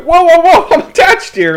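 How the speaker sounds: high, loud startled whoa; startled yell. Retold or imitated. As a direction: yelp as your arm gets twisted. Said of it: "they start twisting my arm around like".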